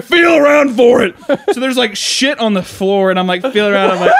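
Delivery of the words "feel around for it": high, loud shouted order; hammed-up impatient command; mock angry dad. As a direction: yell it like an exasperated father. Dad orders kid, exasperated.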